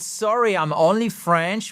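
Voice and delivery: teacher showing a charming self-mocking apology; self-mocking smile. Shown as a demonstration. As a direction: say it with a small self-deprecating smile. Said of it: "avec un petit sourire".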